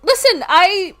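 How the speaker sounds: high, loud, flustered explanation; panicked pleading. Retold or imitated. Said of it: "So I'm panicking".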